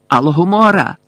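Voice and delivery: loud, high incantation; shouted spell. A narrator's reading. Death Eater blasting the sealed door open, loud spell shout. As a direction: hurl the spell out forcefully.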